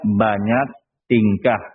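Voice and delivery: slow dictation. Shown as a demonstration. Dictating the lesson line slowly.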